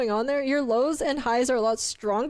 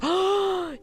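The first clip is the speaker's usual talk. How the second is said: big audible gasp; sharp excited intake of breath; delighted gasp; 4 semitones higher than usual. Acted. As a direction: gasp at a treat on offer. Reacts to donut holes.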